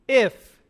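if punched high and loud; stress on if. Real, not acted. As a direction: hit the word "if" hard. Preacher punches the condition.

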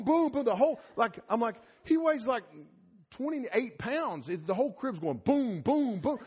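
ordinary talk, nothing marked

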